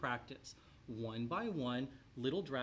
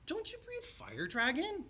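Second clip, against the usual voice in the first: very high, puzzled knight's question; squeaky knight. A narrator's reading. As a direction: ask it in a thin, baffled squeak.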